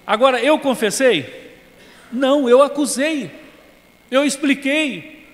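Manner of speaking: slow, loud and high, stressing each word; preacher hammering home the point, slow and loud; emphatic preaching. Real, not acted. Preacher's emphatic rhetorical questions.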